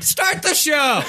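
high slow yell; shout. Real, not acted. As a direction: shout the command. Yelling at co-host.

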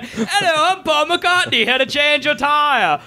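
mock British accent, pitched high; cheery, badly faked British accent; hammed-up British accent, drawn out at the end. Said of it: "The best accent I've ever done".

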